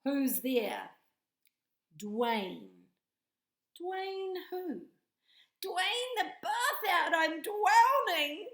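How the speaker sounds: high loud panicked drowning voice; hammed-up joke with a squealing punchline; knock knock joke voices. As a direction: play both sides, end in mock panic.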